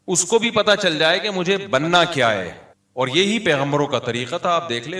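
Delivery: ordinary talk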